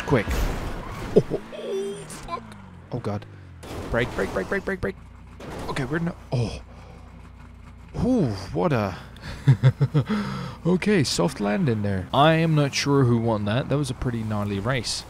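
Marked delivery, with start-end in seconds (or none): none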